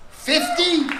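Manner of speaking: high, incredulous "Fifty?"; can't believe the number; shocked. Real, not acted. Shocked at fifty years married.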